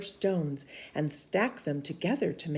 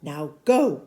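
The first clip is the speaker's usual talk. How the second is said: commanding; 8 dB louder than usual. Acted. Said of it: "with a wave of his arm".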